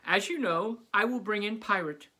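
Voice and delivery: high, loud eager teacher; bubbly teacher announcing surprises. Acted. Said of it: "Missus Zappi continued".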